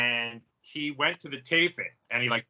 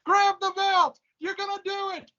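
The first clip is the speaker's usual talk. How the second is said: much higher, excited wrestling commentator call; Jim Ross impression; 4 dB louder than usual. Contest entrant doing Jim Ross calling Jeff Hardy's title win, much higher excited commentary. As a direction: belt it out like a ringside announcer at a title win. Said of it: "So I did Jim Ross calling Jeff Hardy".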